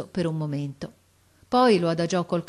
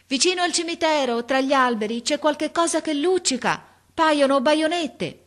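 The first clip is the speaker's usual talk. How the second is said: high-pitched lookout's report; calling out; 10 semitones higher, 5 dB louder than usual. A narrator's reading. Boy reports glints to officer, calling. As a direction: send each phrase down from the treetop.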